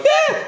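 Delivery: very high comic shriek; mock startled shriek. Man retelling a spider in the shower, mock-frightened squeal. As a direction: squeal "Ah" way up high, half laughing.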